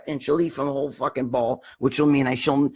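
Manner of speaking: ordinary talk, nothing marked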